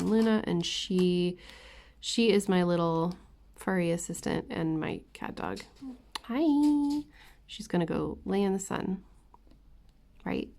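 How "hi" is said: high cooing hi; talking to a dog. Real, not acted. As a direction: chirp hello to the pet. Greeting the dog.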